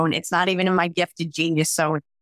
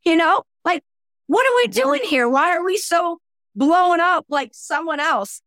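incredulous; 8 semitones higher than usual. Retold or imitated. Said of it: "I was like".

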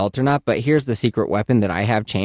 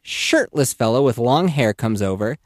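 usual speaking voice

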